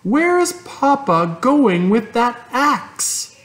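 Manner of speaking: put-on high, slow little girl voice; a worried girl's question, pitched high; young Fern. A narrator's reading. Girl asks mother at breakfast, high and worried.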